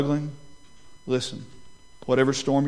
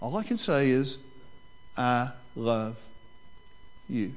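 tender repeat; 2 semitones lower, 7 dB quieter than usual. Shown as a demonstration. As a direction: say it quietly, like a real vow. Repeating the groom's vow gently.

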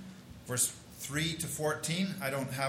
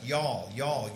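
a light South Texan "y'all" drawn out; South Texan dialect; 3 semitones lower than usual. Pretend. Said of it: "We should read this in South Texan dialect".

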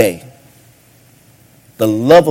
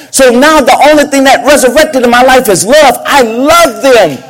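preaching climax; 10 semitones higher, 4 dB louder than usual. Acted. Preacher declaring love for all, full-force preaching. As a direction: lift them up with each line.

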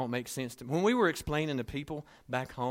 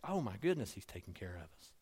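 ordinary delivery

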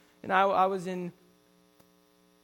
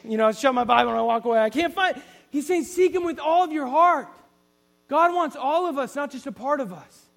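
preaching with force; 6 semitones higher, 10 dB louder than usual. Real, not acted.

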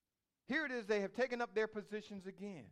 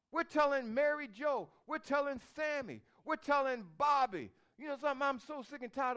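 raised, louder mocking voice; mock complaining; 6 semitones higher, 5 dB louder than usual. Preacher mocking gossip to the congregation, raised mock voice. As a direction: ham up people who gossip instead of praying.

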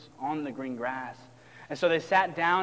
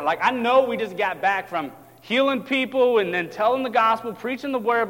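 exasperated protest; 5 semitones higher, 5 dB louder than usual. Acted. Disciples protest to Jesus.

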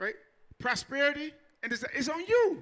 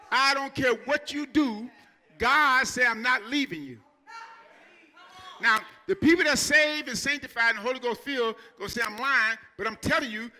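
full-force preaching; 5 dB louder than usual. Acted. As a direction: hammer each line home to the church. Pastor exhorting congregation loudly.

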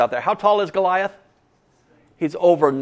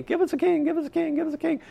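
high-pitched pleading chant of the crowd; whiny crowd crying out; 14 semitones higher, faster than usual. Retold or imitated. The people of Israel demanding a king, whining high. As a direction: beg like a crowd of whining children. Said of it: "crying out for a king".

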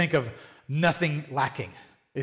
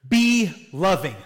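usual speaking voice

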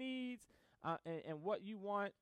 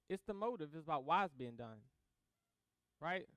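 ordinary talk

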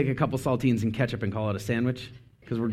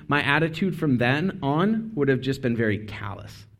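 usual speaking voice

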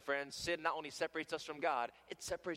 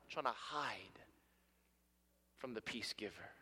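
whisper; 7 semitones lower, 7 dB quieter than usual. Acted. Preacher whispers hiding image.

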